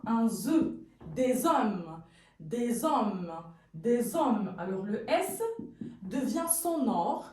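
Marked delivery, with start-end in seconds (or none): 1.1-4.5 des hommes said as dé-zommes; Z liaison. Shown as a demonstration. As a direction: sound the S as Z into hommes. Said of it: "on va entendre un Z".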